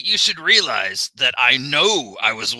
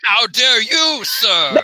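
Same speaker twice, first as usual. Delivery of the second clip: pompous mock indignation, higher; mock outrage; 7 semitones higher, 3 dB louder, slower than usual. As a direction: outdo him with hammed-up offence. Escalating how dare you duel.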